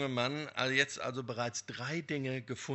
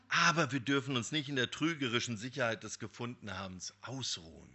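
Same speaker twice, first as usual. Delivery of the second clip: ordinary talk, nothing marked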